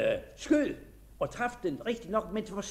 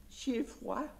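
to herself; 7 semitones higher, 5 dB quieter than usual. Acted. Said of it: "sagde hun ligesom til sig selv".

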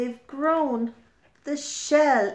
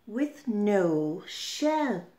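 ordinary delivery, nothing marked

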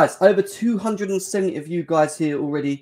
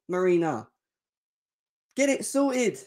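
usual speaking voice

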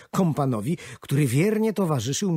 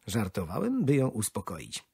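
ordinary delivery, nothing marked

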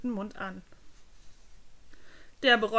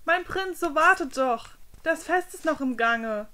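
raised, higher voice calling out; calling after the prince; 6 semitones higher, 6 dB louder than usual. Acted. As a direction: call after him to stop. Servant chasing after the prince.

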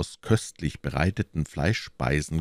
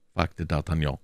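usual speaking voice

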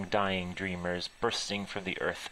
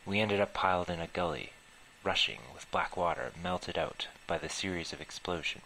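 ordinary delivery, nothing marked

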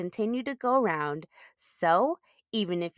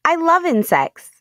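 much higher and louder, thrilled; gushing; 10 semitones higher, 10 dB louder than usual. Acted. Child character squealing about bugs, high and loud. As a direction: squeal it like a kid in love with bugs.

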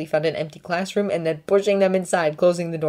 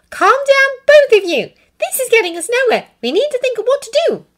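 put-on high, loud girl's voice calming them; calm the two down firmly; Hermione soothing; 9 dB louder than usual. A narrator's reading. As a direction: Hermione calming the boys, firm. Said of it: "holding her hands up in a soothing gesture".

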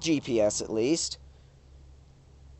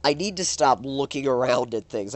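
usual speaking voice

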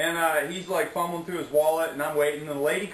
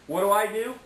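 ordinary talk, nothing marked

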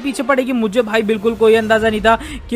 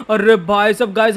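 normal talking voice

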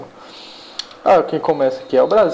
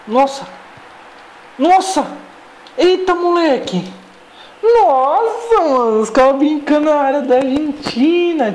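espanto empolgado; 10 semitones higher than usual. Real, not acted. Jogada bonita de dribles.

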